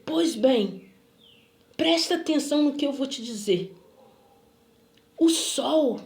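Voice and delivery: emphatic, portentous imitated announcement; acted weighty declaration with long pauses; solemn proclamation. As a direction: proclaim it like a prophet, retold. Retold man's solemn pronouncement.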